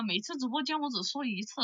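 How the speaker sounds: usual speaking voice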